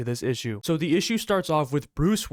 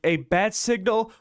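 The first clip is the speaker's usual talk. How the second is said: higher, rising incredulous echo; amused disbelief; 6 semitones higher than usual. Acted. Deadpool scoffing at Bruce's bat signal, amused disbelief. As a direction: throw it back as a mocking question, no real laugh. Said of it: "Deadpool starts laughing".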